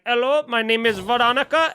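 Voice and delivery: imitating an outsourced FedEx agent's Indian accent; mock call-centre voice. Podcaster mimics FedEx call-centre agent, mock Indian accent. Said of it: "it was an accurate impression".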